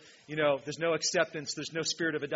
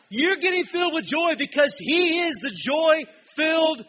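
high, loud, slow preacher's proclamation; full-force preaching; 11 semitones higher, 11 dB louder, slower than usual. Real, not acted. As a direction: proclaim it loudly, drawing the words out.

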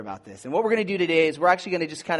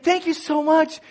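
gushing thanks; 12 semitones higher, 3 dB louder than usual. Retold or imitated.

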